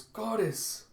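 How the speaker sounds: high-pitched startled cry; startled exclamation. Acted. Kippa waking inside the abyss, startled exclamation.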